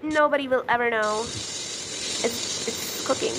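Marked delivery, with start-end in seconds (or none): 1.2-3.3 hushed whisper, waiting on the patty. Real, not acted. Gamer whispering while the patty cooks. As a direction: breathe it out under the breath.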